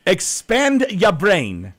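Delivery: slow, each word spelled out high; slow emphatic urging. Real, not acted. Urging a picky listener, slow and emphatic. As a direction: drag it out to make the point. Urging caller to broaden music taste.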